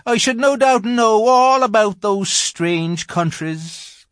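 melancholy. A narrator's reading. Faun regrets his geography to Lucy, melancholy. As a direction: sigh it out as a regretful little faun. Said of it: "in a rather melancholy voice".

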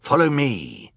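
high, falling villain call; calling back. A narrator's reading. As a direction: call it over your shoulder. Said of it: "he called back".

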